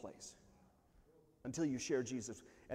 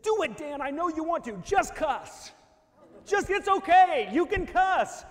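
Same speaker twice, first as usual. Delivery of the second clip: high, loud, egging-on yell; foreman urging him on; 10 semitones higher, 10 dB louder than usual. Retold or imitated. Foreman runs up to worker amid flying beef, shouted goading. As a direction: goad him, building to a full shout. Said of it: "my foreman ran up to me, and he said".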